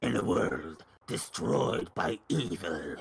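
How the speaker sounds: deep, slow movie trailer narrator; low dramatic announcer, drawn out; trailer guy voice. Acted. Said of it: "can you do the trailer guy voice".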